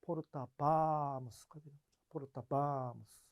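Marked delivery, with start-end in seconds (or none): 2.1-3.2 slow 포르타 바무스 with 바무스 leaned on; stress on 바무스. Shown as a demonstration. Teacher repeats 포르타 바무스 with stress on 바무스. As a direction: land the stress on 바무스, unhurried. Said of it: "거기에 강세가 있어야 되잖아요".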